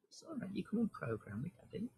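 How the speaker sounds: put-on high, soft and quick baby talk; baby talk to a pet. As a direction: gently babble at the cat. Speaking for or to cat.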